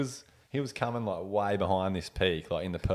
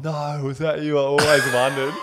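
higher, louder, laughing surprise; delighted recognition; 7 semitones higher, 8 dB louder than usual. Retold or imitated. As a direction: imitate a mate thrilled to find out, voice lifted. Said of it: "so down, going".

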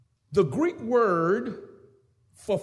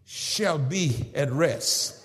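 normal talking voice

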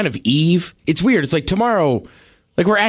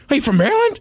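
excited greeting; 10 semitones higher, faster than usual. Retold or imitated. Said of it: "that's always my reaction".

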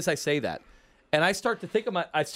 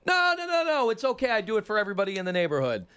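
high, rapid mock protest easing down; insistent neighbor; 5 semitones higher than usual. Racketeer insists on giving the newcomer a gift, high mock insistence. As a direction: brush off the objection in a higher, eager voice. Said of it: "So the guy goes".

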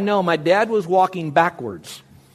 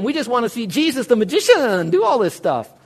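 raised, mocking voice of thrill-seekers; mock the crowd wanting a magic show; mocking quote; 6 semitones higher, 3 dB louder than usual. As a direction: mimic them with a sneer. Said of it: "I'm not gonna mock those".

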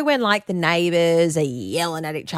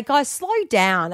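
slow, raised-pitch appeal; mock pleading; 5 semitones higher, slower than usual. Eavesdropper begging two fast talkers, slow mock plea. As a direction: stretch it out like a comic plea.

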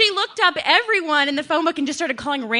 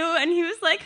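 ordinary talk, nothing marked

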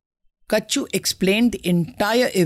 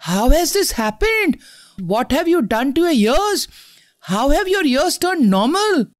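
shocked exclaiming; 8 semitones higher than usual. A narrator's reading. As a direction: gasp it out in disbelief. Mother and Michi shocked.

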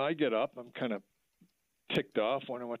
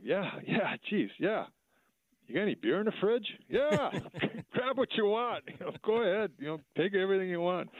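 much higher, eager, tumbling yeses; a fan bursting to please his hero; starstruck excitement; 9 semitones higher than usual. Retold or imitated. Newman asks for beer.

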